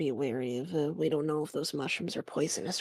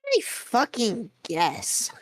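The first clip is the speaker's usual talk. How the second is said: grudging drawl; 6 semitones higher, much slower than usual. Real, not acted. Grudging reluctant agreement.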